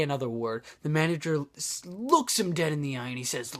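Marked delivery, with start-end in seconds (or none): none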